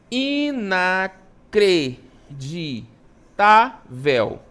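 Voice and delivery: "Inacreditável" very slowly, one syllable at a time; drawn-out teacher's syllable count on "Inacreditável"; split into syllables. Shown as a demonstration. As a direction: break "Inacreditável" into i-na-cre-di-tá-vel. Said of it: "como é que eu separo?".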